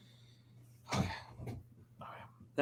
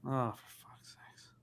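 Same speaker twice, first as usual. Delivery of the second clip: slow, hushed, fed-up whisper; exasperated whisper; 3 semitones lower, 17 dB quieter, slower than usual. Real, not acted. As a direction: hiss it under your breath in disgust. Annoyed at a bad call.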